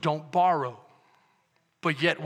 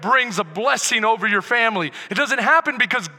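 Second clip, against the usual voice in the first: loud, higher, driving sermon; full-force preaching; 5 semitones higher, 8 dB louder than usual. Acted. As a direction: preach it hard to the congregation.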